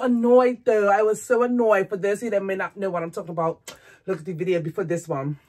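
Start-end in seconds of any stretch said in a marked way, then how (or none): none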